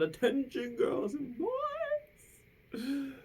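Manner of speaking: high, loud drawn-out howl; howled call. Acted. Principal calls the noisy lunchroom to attention, howling. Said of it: "Mister Lowe appeared and howled".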